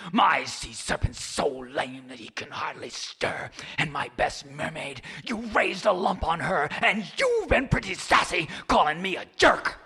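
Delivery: a hint of a mad old sea god; angry old man of the sea. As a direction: scold him, building anger. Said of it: "madder than a hornet".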